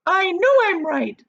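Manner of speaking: high, loud insistence; indignant. A narrator's reading. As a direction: insist hotly. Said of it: "said Missus Gloop".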